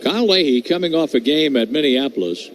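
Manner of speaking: normal talking voice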